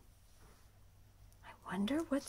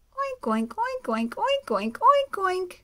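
high, loud rapid oinks; piglets oinking; 9 semitones higher, 10 dB louder than usual. Acted. Baby pigs at breakfast, high loud oinks. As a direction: chatter like hungry piglets. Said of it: "say the babies".

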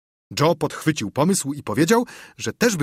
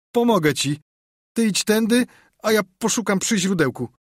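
put-on queasy boy's high, trembling voice; trembling, saving breath; 9 semitones higher than usual. A narrator's reading. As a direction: speak up high, sparing your breath. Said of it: "drżącym głosem, oszczędzając oddech". (drżącym, wysokim głosem, oszczędzając oddech)

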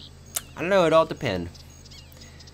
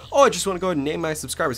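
generic YouTuber; 7 semitones higher, 4 dB louder, much faster than usual. Retold or imitated. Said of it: "they'll just go".

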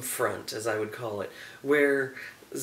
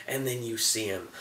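ordinary talk, nothing marked

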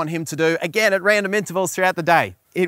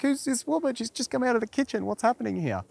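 confused surprise; 8 semitones higher, 3 dB quieter than usual. Pretend. Boyfriend baffled by stranger role-play.

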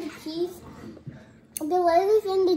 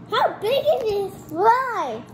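surprised gasp; 6 semitones higher, 4 dB louder, much slower than usual. Real, not acted. Amazed at huge candy fry.

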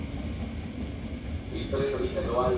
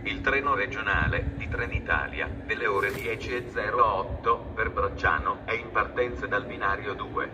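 PA loudspeaker announcement voice; echoing station tannoy; train station announcement; 4 dB louder than usual. Real, not acted.

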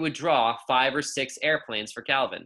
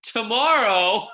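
higher, louder, slow incredulous "Tomorrow?"; 9 semitones higher, 7 dB louder, slower than usual. Acted. Girl scoffs at the yard teacher's question, incredulous. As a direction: scoff at the very idea, drawn out.

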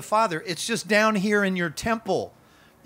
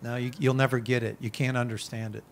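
low, softer discouraging voice; imitated enemy; 6 semitones lower, 4 dB quieter than usual. Preacher voicing the enemy discouraging believers, low and subdued. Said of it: "that's the enemy".

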